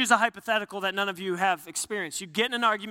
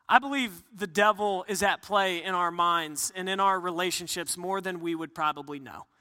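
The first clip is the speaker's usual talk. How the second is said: ordinary delivery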